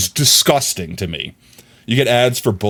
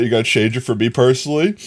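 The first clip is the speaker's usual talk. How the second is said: ordinary talk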